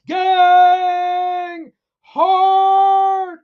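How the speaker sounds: very high, loud, long held words; drawn-out hype holler; slogan shout. Acted. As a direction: belt each word and hold it. Shouting chat words one by one.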